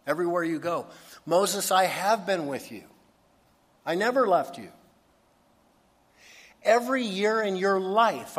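impassioned promise, driven home; emphatic assurance. Retold or imitated. God emphatically assures Moses of presence.

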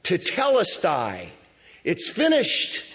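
high, loud, slow triumphant shout; cry of victory. Shown as a demonstration. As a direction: roar it like a champion at the finish line. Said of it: "the cry of victory".

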